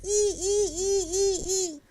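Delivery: very high, squeaky mewing; high kitten squeaks, imitated; squeaky kitten cries.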